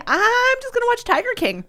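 high, whiny giving-up voice; lazy self-quote. Retold or imitated. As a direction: sound like you've given up. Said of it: "And I'm like".

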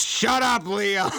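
a dictator silencing his adviser; mock angry yell. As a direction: cut him off mid sentence. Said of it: "And then he goes".

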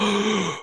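sharp imitated gasp; mock gasp; mock audience gasp. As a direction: gasp like a shocked crowd. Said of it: "They're like".

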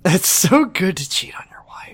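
whispered mock aside; sarcastic whisper. Joking to her co-host in a sarcastic whisper. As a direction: breathe it like a secret.

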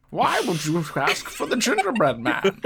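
high, swooping villain question; hammed-up masked-villain voice; supervillain impression. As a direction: lament grandly as a mock villain. Continues the Bane voice bit.

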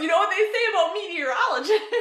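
high loud laughing question. Real, not acted. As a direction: ask while cracking up. Laughing while asking.

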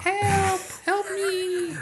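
squeaky high call; tiny high cry for help. Pretend. Voicing the kidnapped grandson.

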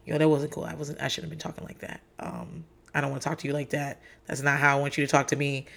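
low, soft, contrite backpedal; retold climb-down. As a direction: drop right down and take it back. Instant climb-down after retold outburst.

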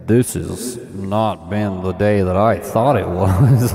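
slow, loud, grand pronouncement; dramatic declaration. Retold or imitated. Husband declaring to his sleeping wife, slow and grand. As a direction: declare it to your wife like a revelation. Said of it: "I say".

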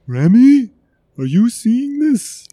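put-on frightened young cook babbling excuses; nervous cook voice. Remy frightened, NPC voiced.

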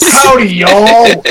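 slow, hammed-up Southern cowboy drawl; mock cowboy accent. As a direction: drawl it like a cartoon cowboy, half crying with laughter. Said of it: "and dress like cowboys".

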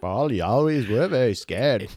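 sing-song it as a dopey Liverpool drummer; mock British lilt. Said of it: "so I could be [name]".

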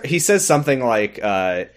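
ordinary talk

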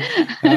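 short high laugh; burst of laughter. Real, not acted. Host amused by the [name] story, laughing.

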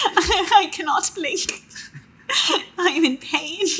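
put-on slow, high child voice through laughter; British baby voice. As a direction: play the suffering British tot, giggling. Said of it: "That's my British baby voice".

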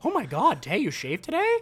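high, loud fake amazement; mock surprise. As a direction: gasp it like a shocking discovery. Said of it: "and we're like".

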